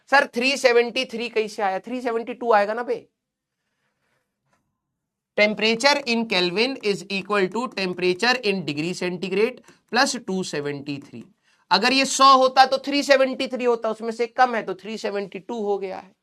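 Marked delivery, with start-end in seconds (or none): 5.4-11.2 spelling out the formula word by word, very slowly; slow dictation. Shown as a demonstration. Teacher dictating a formula to his online class, very slow. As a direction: dictate it slowly so students can write.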